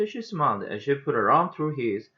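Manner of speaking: plain reading voice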